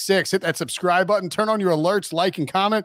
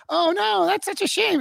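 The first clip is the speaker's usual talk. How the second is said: mock fake sympathy; 13 semitones higher than usual. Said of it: "he tried to act like".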